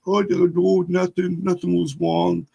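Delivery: put-on high, small-boy voice with childish pronunciation; little kid speech. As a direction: deny it like a six-year-old. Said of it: "Still had, like, the little kid speech".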